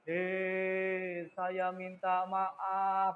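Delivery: soft, very slow, ghostly sorry; creepy disembodied voice. Shown as a demonstration. Teacher imitates a scary bodiless apology, very slow and soft. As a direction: drift it out like a voice with no one there. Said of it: "itu menakutkan".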